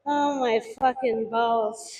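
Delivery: ordinary delivery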